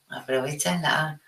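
ordinary delivery

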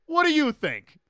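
loud, high, sarcastic comeback; hammed-up movie-quote outburst; mock scornful retort. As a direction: throw it back as an obvious insult. Movie character's rude reply, quoted.

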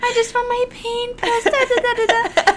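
high, whiny, half-asleep patient; high whiny groggy voice. Retold or imitated. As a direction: whine like a drowsy patient. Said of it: "You said".

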